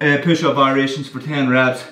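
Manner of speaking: ordinary talk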